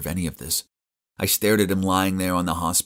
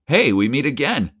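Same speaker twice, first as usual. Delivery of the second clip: calling out; 7 semitones higher, 5 dB louder than usual. Acted. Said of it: "I called out".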